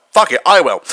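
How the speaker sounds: ordinary talk, nothing marked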